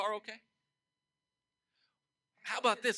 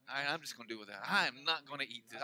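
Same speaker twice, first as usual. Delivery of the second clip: resigned mutter; 8 semitones lower, 9 dB quieter than usual. Retold or imitated. Retold resignation, dropped voice.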